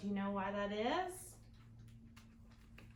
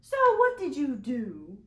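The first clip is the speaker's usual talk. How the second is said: higher, louder storybook teacher; teacher character; 5 semitones higher, 14 dB louder than usual. Acted. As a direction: play the teacher asking, a touch bossy.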